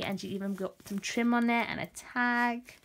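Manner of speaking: ordinary talk, nothing marked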